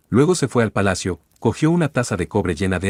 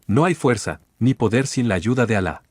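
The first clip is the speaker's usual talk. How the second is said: ordinary delivery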